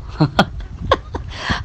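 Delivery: soft unvoiced chuckle; breathy laugh. Real, not acted. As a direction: let out a quiet breathy laugh. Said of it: "aku gelak". (ketawa kecil tanpa suara)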